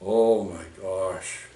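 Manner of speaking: slow, low, soft and stunned; stunned shock. Retold or imitated. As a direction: let it drain out of you, slowly. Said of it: "And I'm going like this".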